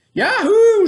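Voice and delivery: high, loud whoop; joyful shout. Acted. Bubba whooping as he gallops off to the ball. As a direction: yell it out as you leap on the horse. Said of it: "shouted Bubba".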